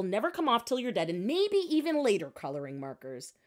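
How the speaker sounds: plain reading voice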